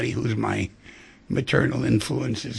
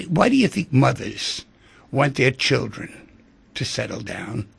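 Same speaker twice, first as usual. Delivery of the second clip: normal talking voice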